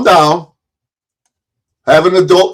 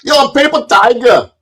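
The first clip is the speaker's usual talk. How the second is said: high mocking mimicry of a heckler; Hank Flamo; 9 semitones higher than usual. Streamer mimicking a rival's favorite insult, high mocking voice. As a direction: jeer it high, drawing out the insult. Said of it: "Hank Flamo calls me".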